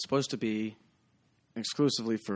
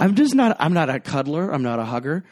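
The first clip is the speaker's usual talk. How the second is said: louder and higher, still laughing; laughing protest; 4 semitones higher, 9 dB louder than usual. Real, not acted. Bartender insisting to the host he's no cuddler, laughing.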